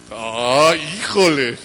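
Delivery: loud hammed-up groan of complaint; mock whining man. Pastor mocking men who make a show of small pains, loud mock complaint. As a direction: overplay a man's fuss.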